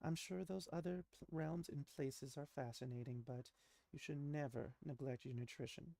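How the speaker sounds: a mother lecturing her daughter about eating, softly; fussing mother. Acted. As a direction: chide her child kindly but firmly. GM voices the mother.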